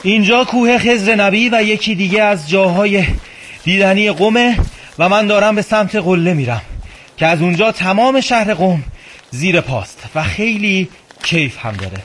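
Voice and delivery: panting while climbing uphill; breathless, talking between breaths. Real, not acted.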